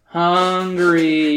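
loud, drawn-out chapter title; dramatic title read. Acted. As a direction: announce it like a heading.

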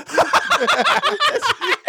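high-pitched burst of laughter; squealing laugh. Real, not acted. Laughing at own story.

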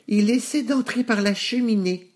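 high-pitched piglet alert; piglet announcing. A narrator's reading. Nouf Nouf warning his brothers of the wolf, high alert. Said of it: "annonça Nouf Nouf".

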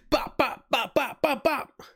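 high-pitched popping gunshots; imitated gunfire. Retelling Joel going Rambo on everyone, playful gunshot noises. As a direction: fire off punchy pairs of mock shots.

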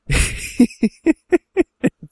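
high-pitched panicked squealing; imitated frantic squeal; freaking-out squeals. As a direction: squeal like a terrified boy. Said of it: "his son's freaking out".

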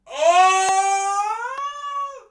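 very high, loud, long "oh"; sustained high-pitched shriek; excited scream. Real, not acted. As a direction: scream at a huge reveal. Reacting to He-Man being drawn.

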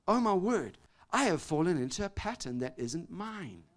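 ordinary talk

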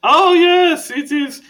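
high, loud, theatrical vampire greeting; Skurlock the vampire. Acted. Skurlock voice continues.

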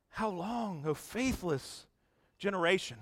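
quiet, heavy-hearted echo of the rebuke; soft weary lament. Retold or imitated. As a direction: breathe the words like a tired sigh. Preacher quotes Jesus again softly.

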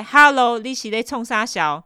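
incredulous inner snark; mock inner retort. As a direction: snap back in your head. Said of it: "内心独白".

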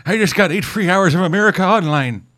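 put-on high, eager old dad voice; proud grandpa. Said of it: "it's like".

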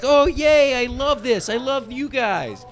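high, gushing puppy-like joy; excited golden retriever. Retold or imitated. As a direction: bounce like an overjoyed dog. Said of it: "He's like".

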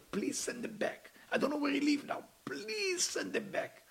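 imploring, stretched-out wail; tearful begging. Retold or imitated. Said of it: "He started begging the Creator".

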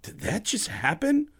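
high, slow, amazed question; stunned disbelief. Retold or imitated. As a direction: ask it slowly, dazed with amazement. Stunned after hanging up.

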